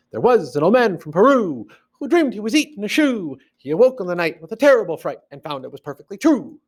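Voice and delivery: fast bouncy high limerick recital; sing-song verse punching WAS, MAN, pe-RU; rhythm and meter. Shown as a demonstration. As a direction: rattle it off high, riding the beat. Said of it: "has a rhythm and a meter".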